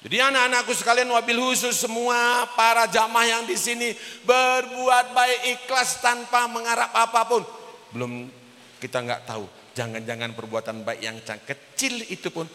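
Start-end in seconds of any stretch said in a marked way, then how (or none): 0.1-7.4 loud, high, sustained exhortation to the congregation; forceful preaching. Real, not acted. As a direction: drive the moral home in a raised sermon voice. (berkhotbah lantang, nada tinggi)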